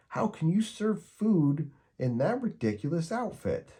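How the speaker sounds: put-on high, cross boy's voice scolding the dog; scowling Charlie Brown. A narrator's reading. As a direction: scold Snoopy like a fed-up kid. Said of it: "he scowled Snoopy".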